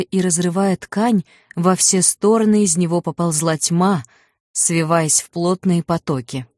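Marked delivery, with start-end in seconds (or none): none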